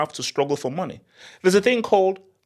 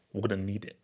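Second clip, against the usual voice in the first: low, soft aside; hushed, matter-of-fact demand; low-key phone request; 6 semitones lower, 12 dB quieter, faster than usual. Acted. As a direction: say it low and quietly. Acts the phone call.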